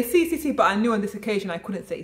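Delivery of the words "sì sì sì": eager yes. Retold or imitated. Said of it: "I say".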